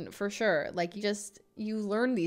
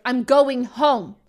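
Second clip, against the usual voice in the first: loud, drawn-out dramatic announcement; mock-heated, stretching each word; retold heated declaration; 3 semitones higher, 9 dB louder, much slower than usual. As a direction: reenact a huffy exit, slow and loud. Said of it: "I was so heated".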